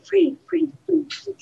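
echoing, fading away; echo fade. Pretend. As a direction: let each free fade out.

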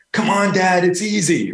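high-pitched mock child egging dad on; imitating his daughter. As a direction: mimic an eager kid calling to dad. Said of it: "And then she goes".

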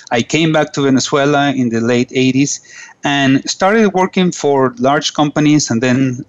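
ordinary delivery

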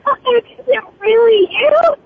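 high, slow, thrilled gasp of a question; can't believe it's really her; delighted disbelief. Real, not acted. Co-star greets surprise caller with high, slow disbelief.

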